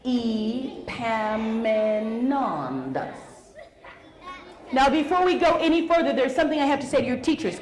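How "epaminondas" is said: Epaminondas said very slowly, ee-PAM-i-NON-das; teacher drawing out Epaminondas for kids; name spelled out slowly. Shown as a demonstration. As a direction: stretch every syllable of Epaminondas. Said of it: "you get".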